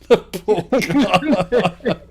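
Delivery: helpless high laughter through the words; laughing. Real, not acted. Teller pitying the old Mainlander, laughing hard. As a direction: laugh it out, drawn out.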